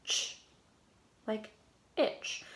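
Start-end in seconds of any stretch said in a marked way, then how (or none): 0.0-0.4 Ch for T C H, quick and quiet; ch sound. Shown as a demonstration. Teacher reviewing the T C H sound with students. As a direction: say the tch sound alone, no vowel. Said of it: "this T C H also says".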